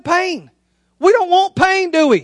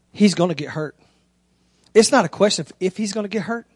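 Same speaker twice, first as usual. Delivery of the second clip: low, softer, serious coach voice; level with a worried mother, low and sober; grave warning; 8 semitones lower, 5 dB quieter than usual. Retold or imitated.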